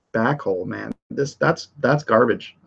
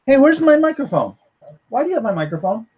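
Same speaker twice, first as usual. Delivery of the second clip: high indignant call across to someone; exasperated, raised-pitch questioning; calling out complaint; 13 semitones higher, faster than usual. Real, not acted. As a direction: demand it back in a high voice. Calls to son who took microphone.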